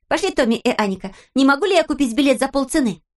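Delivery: put-on high, lively girl's voice; Pippi voice. A narrator's reading. Narrator voicing Pippi, high and perky. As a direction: play Pippi as a cheeky girl.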